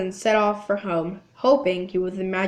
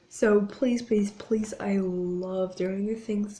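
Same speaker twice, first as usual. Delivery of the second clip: whispering; 4 dB quieter, slower than usual. Real, not acted. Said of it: "begging viewers softly".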